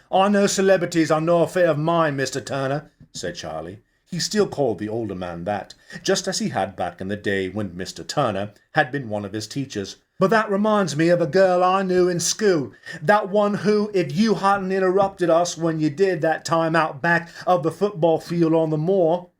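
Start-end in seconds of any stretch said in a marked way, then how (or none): 0.1-2.9 fast, higher, louder retort; quick retort. Acted. Charlie replies to teacher. 10.2-19.3 higher, louder, teasing barman; teasing Charlie. Acted. Charlie teasing old teacher.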